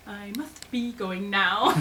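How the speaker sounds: slow, drawn-out sticky-mouthed Pooh. Retold or imitated. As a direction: stretch the words as if stuck with honey. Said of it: "Pooh says in a very sticky voice".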